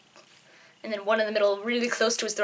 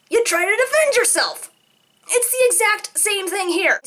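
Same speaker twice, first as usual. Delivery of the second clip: furious rant; 9 semitones higher than usual. Real, not acted. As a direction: scream at the idiots who poked the tiger. Ranting at tiger-baiters.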